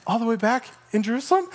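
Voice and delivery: balking at a long trip, voice rising; incredulous question. Acted. Soldier balks at long trip.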